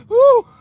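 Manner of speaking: loud high shouted woo; imitated woo. Mimicking the singer's yelp, loud high woo. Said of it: "And he goes".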